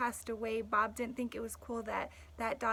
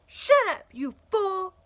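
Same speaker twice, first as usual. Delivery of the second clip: high, loud barked "Shut up, you fool!"; yelled insult; 8 semitones higher, 12 dB louder than usual. Acted. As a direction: shout it like a mean owner.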